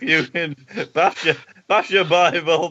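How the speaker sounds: ordinary delivery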